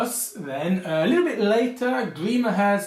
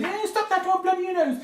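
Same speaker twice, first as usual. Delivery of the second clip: put-on much higher childish squeak; mock child; 12 semitones higher than usual. Kindergartner standing up to a bully, high squeaky. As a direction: play a small kid standing up. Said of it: "stood up to you and said".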